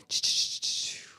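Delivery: breathy whispered ch-ch-ch of a rush; whispered rush sound. Retold or imitated. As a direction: hiss out the thrill of winning. Imitating a dopamine rush sound.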